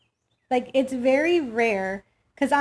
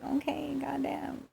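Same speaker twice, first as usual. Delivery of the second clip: whispered, barely audible grumble; muttered under breath; 14 dB quieter than usual. Retold or imitated. Daughter grumbling under her breath after dad's scolding. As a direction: whisper it so dad can't hear. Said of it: "And I'm just like".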